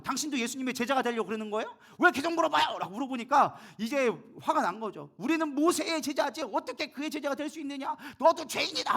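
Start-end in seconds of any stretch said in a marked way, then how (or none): 0.0-2.8 exasperated retort rising to high and loud; angry retort. Retold or imitated. Blind man fed up with the Pharisees' questioning, acted angry outburst. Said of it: "맹인도 화가 나가지고". 5.2-8.9 flaring anger. Retold or imitated. Pharisees lashing out at the blind man, acted furious outburst. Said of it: "막 화를 버럭버럭 내기 시작하는".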